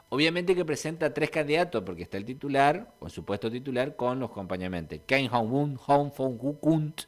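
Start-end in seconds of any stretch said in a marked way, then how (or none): 5.0-7.0 Kang Hong-un, Hong Fong-gu Kunt, separados y trabajosos; nombres difíciles de pronunciar. Real, not acted. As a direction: read Kang Hong-un, Hong Fong-gu Kunt as hard foreign names. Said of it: "Estos nombres, Dios mío, para pronunciarlo". (Kang Hong-un, Hong Fong-gu Kunt, sílaba por sílaba)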